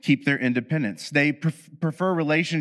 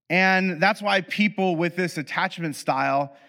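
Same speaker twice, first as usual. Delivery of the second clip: normal talking voice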